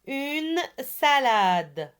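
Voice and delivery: UNE SALADE slowly, each word drawn out; slow model for repetition. Shown as a demonstration. Teacher gives pupils a word to repeat, slowly drawn out. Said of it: "On répète".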